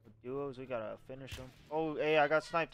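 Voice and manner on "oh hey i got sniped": sudden loud startled call; surprised outburst. Real, not acted. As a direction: blurt it out, caught off guard. Player just got sniped mid-match.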